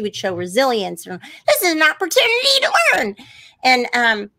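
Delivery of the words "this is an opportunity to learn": high, fast bright Muppet voice; chirpy puppet girl voice; Abby voice. Retold or imitated.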